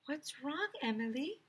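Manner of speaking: soft, high and tender; teacher gently asks upset birthday girl; gentle concern. A narrator's reading. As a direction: ask softly like a kind teacher. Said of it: "asked Mister Mackey".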